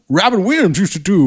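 high-pitched mock prospector; high, hammed-up old-timer impression. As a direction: imitate a comic old prospector. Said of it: "the old prospector voice".

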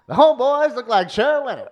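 high, loud vintage reporter voice; old-timey voice. Acted. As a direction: savour the line like an old sportswriter. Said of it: "Old-timey voice".

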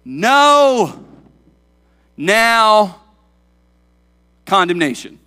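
"No" high, loud and long, then "now" and "condemnation" set apart; clunky literal translation. Shown as a demonstration. Preacher showing clunky word order, "No, now, condemnation" in emphatic separate words. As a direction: isolate "No", "now", "condemnation" with big pauses, hitting "No" hardest. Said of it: "looking at a literal translation, it's kind of clunky".

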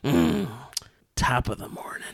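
low, whispery, weird growled greeting; Judi Dench. Retold or imitated. Showing co-hosts her entrance, weird low smoker voice. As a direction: drop to a hushed, smoker's rasp. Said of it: "not, like, in her normal human voice".

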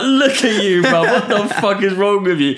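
loud, high jeer with laughter; imitated taunting. Storyteller replays taunting the driver on the roundabout, laughing jeer. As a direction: jeer at him, half laughing.

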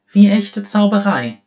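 hoch und laut vor Staunen; begeisterter Ausruf. Acted. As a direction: ruf es voller Bewunderung. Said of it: "rief sie". (Oriana bewundert Fiarams Zaubertrick, hoch und laut)

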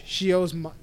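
ordinary talk

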